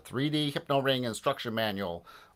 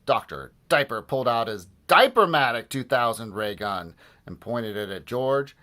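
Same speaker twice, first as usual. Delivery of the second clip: ordinary delivery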